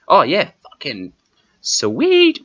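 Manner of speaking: squealing high and loud; excited cheer. Real, not acted. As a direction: yelp it out with glee. Cheering about the website.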